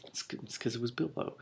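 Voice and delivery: soft quiet aside; muttered joke. Real, not acted. Reader guessing the thief, quiet aside. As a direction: mumble it as a joke.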